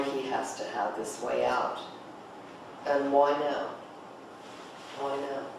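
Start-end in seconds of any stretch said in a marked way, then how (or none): none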